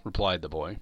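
ordinary delivery, nothing marked

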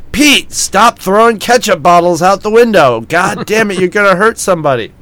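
acted exasperated yell at a friend; mock scolding. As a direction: call out blame in fake outrage. Randy blaming Pete, shouting out window.

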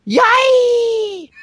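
very high, drawn-out squeal; hammed-up falsetto scream; mock panicked scream. Voicing the guy closest to Protoceratops, high mock scream. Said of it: "First guy closest to Protoceratops says".

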